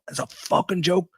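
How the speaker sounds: half-acted fury at the crew; retold rant. Actor venting at a stagehand backstage, mildly mock-furious. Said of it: "I am giving him everything I have".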